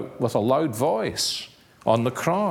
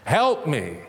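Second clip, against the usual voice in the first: put-on child crying for daddy; 7 semitones higher, slower than usual. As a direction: call out for daddy like a boy who just fell. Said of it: "you shouted".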